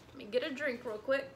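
plain reading voice

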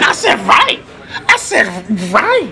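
high, sarcastic repeat; sneering back at the officer; mocking echo. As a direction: throw his word back at him.

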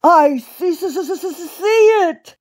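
high, panicky stuttering cry; shouted stammer. A narrator's reading. Old rabbit speeding toward puddle, high stammering shout. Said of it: "shouted Uncle Wiggily".

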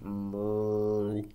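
long, low cow moo. Acted. Reader demonstrates cow moos for the book, low.

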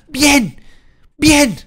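high loud cheering; thrilled gamer cheer; excited cheer. Real, not acted. Celebrating a win in battle.

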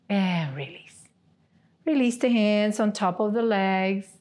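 guided relaxation. Acted. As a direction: talk them down into stillness, unhurried. Leads tense-release relaxation.

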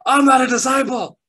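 high, loud frantic protest; panicked protest. Retold or imitated. Admitting to his wife he isn't saved, panicked high protest. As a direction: plead it in a panic. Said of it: "I'm like".

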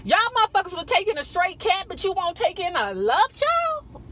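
outraged squeal of disbelief, then rapid ranting; exasperated disbelief. Retold or imitated. Reacting to women who reject a love child, shocked and high-pitched. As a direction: voice jumps way up on Jesus, then rushes on fast. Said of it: "I'm like".

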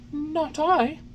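high, breathless puppet stammer; stammered denial. A narrator's reading. Pinocchio denying to the officer, stammering. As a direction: stammer it out, hardly any breath. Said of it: "stammered the marionette".

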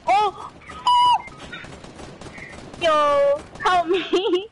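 panicking gamer. Real, not acted. Getting shot in game.

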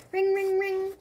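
high, voiced phone rings; phone ringing. A narrator's reading. Narrator imitating the video phone, high ringing. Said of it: "The video phone rings".

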